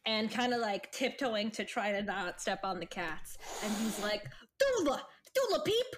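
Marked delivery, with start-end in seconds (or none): 4.6-5.9 high-pitched tentative character voice; hopeful, higher-pitched greeting; Jeb greeting an old acquaintance. Acted. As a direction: call out in a high little voice.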